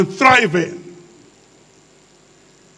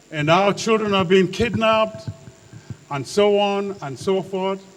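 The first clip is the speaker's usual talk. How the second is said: usual speaking voice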